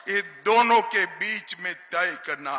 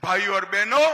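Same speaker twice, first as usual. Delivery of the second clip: ordinary talk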